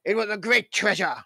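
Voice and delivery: slow, lightly voiced old pirate; pirate storyteller. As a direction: spin a yarn like a pirate. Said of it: "Yes, he's a pirate".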